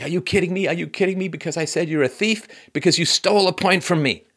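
high-pitched, incredulous protest; mock outrage, nearly tearful; Serena Williams, angry, tearful. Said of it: "really angry. She was almost in tears".

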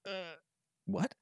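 hesitate, then ask softly in bewilderment; confused, tentative little voice; baffled. Retold or imitated. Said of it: "There was kind of this pause".

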